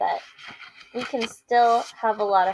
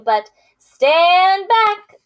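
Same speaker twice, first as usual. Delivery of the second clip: louder, higher, slow boast; boastful warning; 11 semitones higher, 12 dB louder, much slower than usual. Acted. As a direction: make way for the champion, slowly. Pig boasts, warning elephant.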